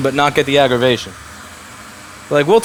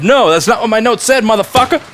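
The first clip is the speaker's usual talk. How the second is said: confident angry scream; 9 semitones higher, 5 dB louder than usual. Shown as a demonstration. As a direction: blow up and yell down a lying caller. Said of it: "I need you to scream".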